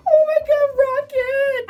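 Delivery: real outburst over the film; overwhelmed cry. Real, not acted. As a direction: cry out his name, overwhelmed. Overwhelmed reaction to the movie.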